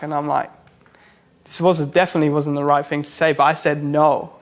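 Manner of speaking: usual speaking voice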